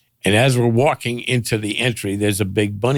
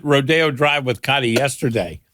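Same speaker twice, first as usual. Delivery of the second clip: normal talking voice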